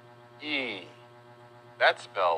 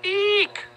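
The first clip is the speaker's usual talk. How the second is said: very high, squeaky eek; mock scream; 4 dB louder than usual. Teacher introducing a mouse, playful high shriek. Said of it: "This animal makes a lot of people scream".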